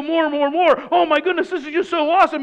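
loud, high gushing; hammed-up giddy cheering; mock excitement. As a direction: gush like a thrilled fan. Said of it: "get all excited and go".

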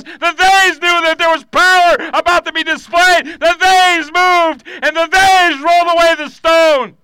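high, loud preacher's delivery; full-force preaching. Acted. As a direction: drive each phrase home like a fired-up preacher.

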